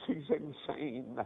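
low, soft, slow repetition; subdued grief. Retold or imitated. Mother repeating the news to the preacher, low and soft. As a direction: drop to a spent, hushed lament.

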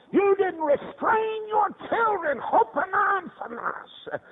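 high, slow shouted indictment; impassioned preaching. Real, not acted. Preacher voicing God's rebuke of Eli to the congregation, high shout. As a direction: cry God's charge out at full force.

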